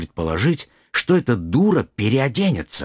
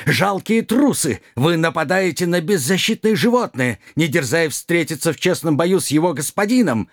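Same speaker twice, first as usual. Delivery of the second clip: angry knight; 7 semitones higher than usual. Acted. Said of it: "В ярости".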